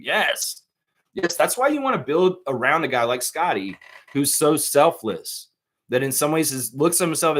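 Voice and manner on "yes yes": normal talking voice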